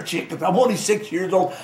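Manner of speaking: ordinary delivery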